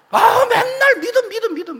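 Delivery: high, whining groan of fed-up complaint; whiny complaint. Retold or imitated. Church member grumbling about the pastor, whiny fed-up moan. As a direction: moan it like you're sick of hearing it. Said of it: "그럼 뭐라 그래요?".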